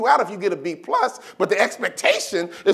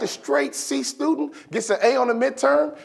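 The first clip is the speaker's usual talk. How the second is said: usual speaking voice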